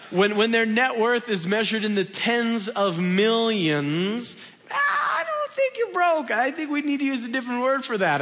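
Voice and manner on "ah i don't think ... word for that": sudden high yelp, then fast and high; mock outburst. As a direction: jump way up as if scandalised, then rattle on. Mock rebuke to Mrs Clinton.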